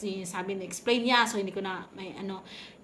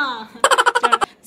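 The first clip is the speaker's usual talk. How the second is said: burst of laughter; 12 semitones higher, 12 dB louder than usual. Real, not acted. Laughs at own scream.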